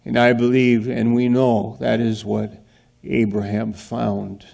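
ordinary talk

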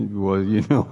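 usual speaking voice

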